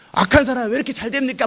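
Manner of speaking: high-pitched mock complaint; arguing. Ordinary believer briefly arguing with God, complaining. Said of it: "막 따지다가 또 잊어버려요".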